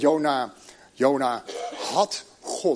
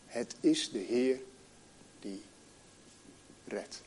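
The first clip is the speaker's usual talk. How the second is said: softer still, redt almost breathed; preacher's hushed echo to the congregation; near whisper; 6 semitones lower, 11 dB quieter than usual. Acted. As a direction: echo the verse, fading to a whisper. Echoes the verse, fading.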